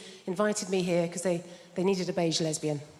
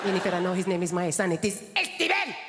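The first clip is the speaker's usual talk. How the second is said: put-on Spanish mum accent; 2 semitones lower than usual. Spanish mum insisting to her daughter, indignant accent. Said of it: "she's never lost her accent".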